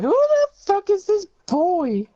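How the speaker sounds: very high, loud, drawn-out screech; outraged parents. Pretend. Imagined parents finding a boy on the stream, hammed-up high screech. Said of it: "her parents were like".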